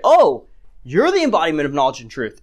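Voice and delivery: mock-impressed sarcasm with a big "Oh"; sarcastic. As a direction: feign being impressed by them. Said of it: "But he's doing it sarcastically".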